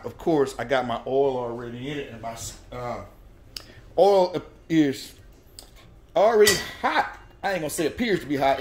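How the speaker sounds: usual speaking voice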